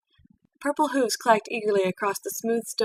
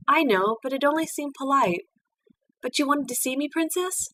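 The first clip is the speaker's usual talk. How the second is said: put-on higher, bright young mare voice; Twilight's voice; 6 semitones higher than usual. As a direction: play the eager young pupil, a little shy. Twilight speaks to Celestia.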